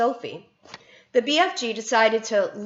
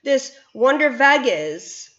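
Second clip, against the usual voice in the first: higher, loud eager coaxing; eager BFG; 5 semitones higher, 5 dB louder than usual. A narrator's reading. BFG coaxing Bloodbottler to bite, eager. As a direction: gush with excitement.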